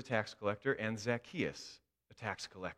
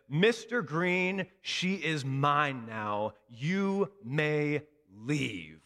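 firm confrontation; 3 semitones higher, 4 dB louder, much slower than usual. Retold or imitated. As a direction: stand tall and dismiss him. Teacher stands up tall against him.

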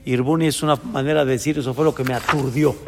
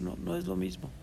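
disappointed quote; 4 semitones higher, 14 dB quieter, faster than usual. Retold or imitated. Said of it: "llegó a decir".

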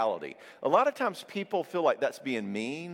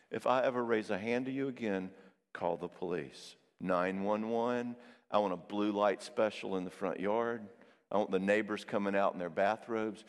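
low, solemn imitated vow; sincere pledge; 4 semitones lower than usual. Husband pledging accountability to wife, retold solemnly.

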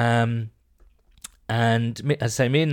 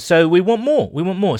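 excited demand; 7 semitones higher, 7 dB louder than usual. Real, not acted. Hyping the audience for entries.

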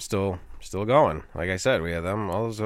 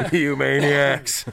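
slow, dramatic, higher cry of despair; Charlton Heston; 5 semitones higher, 5 dB louder, much slower than usual. Retold or imitated. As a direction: wail it like a movie hero on the beach. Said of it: "Like Charlton Heston on the beach".

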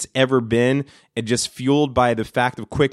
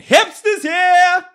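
high, loud drawn-out yell; screamed phrase; 5 dB louder, much slower than usual. Shown as a demonstration. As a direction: yell it far above your talking pitch. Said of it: "other things that I scream".

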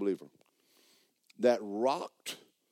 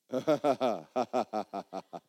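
long staccato put-on laugh; hammed-up ha-ha-ha, on and on; Kenneth Hagin. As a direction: imitate a defiant laugh in repeated bursts. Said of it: "Kenneth Hagin went".

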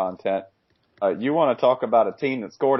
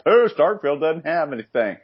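higher-pitched whining-visitor imitation; mock complainer; 7 semitones higher, 3 dB louder than usual. Podcaster imitating people who knock Starkville, mock complaining. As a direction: mimic a griping visitor, pitched up. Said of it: "When people are like".

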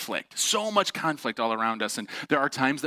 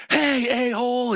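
high, loud mock road-rage yell; raised-voice angry outburst, retold; freaking out; 10 semitones higher, 4 dB louder than usual. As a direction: imitate an irate driver hollering an insult. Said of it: "starts freaking out right away".

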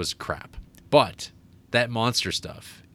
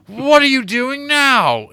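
slow, booming mock-theatrical shout; Charles Dance; 14 semitones higher, 10 dB louder, slower than usual. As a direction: draw it out high and loud, hammed up. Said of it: "Charles Dance shows up".

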